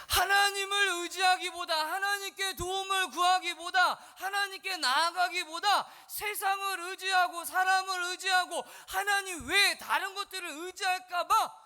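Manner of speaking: high, loud, slow declamation; full-force pulpit cry; forceful preaching. Real, not acted. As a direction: hammer each phrase out to the congregation.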